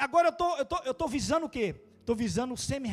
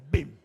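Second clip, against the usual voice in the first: crash sound; 7 semitones lower, 8 dB louder than usual. Retold or imitated. Car crash into his car.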